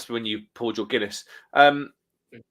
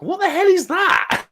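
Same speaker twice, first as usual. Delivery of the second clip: high, slow incredulous squeak; stretched-out high what-the-hell; baffled disbelief; 5 dB louder, slower than usual. Real, not acted. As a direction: sound utterly bewildered by it. Baffled by a drink combo.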